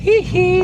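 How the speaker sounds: falsetto hee hee squeal; high squeal. Pretend. Streamer squeals a joking pop-star hee hee.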